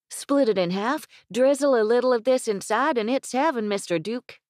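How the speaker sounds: put-on high, loud eager boy's voice; excited little boy selling his treat; young boy. Grandson urging the guest to try honey, high and excited.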